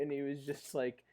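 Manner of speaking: ordinary delivery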